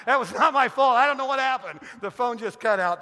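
loud, hammed-up protest of innocence; mock defensive. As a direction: make excuses loudly to your wife. Mock-defensive excuse for dropped call.

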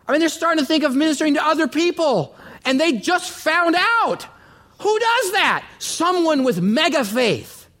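high-pitched, incredulous preaching; astonished, pitch leaping way up; amazed exclamation. Real, not acted. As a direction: marvel aloud, voice soaring high. Preacher marvels at couple's faith.

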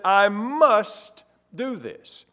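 very slow, every word weighed; resolute Jesus. Acted. Jesus declaring his purpose, slow and firm. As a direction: lay down each word with resolve.

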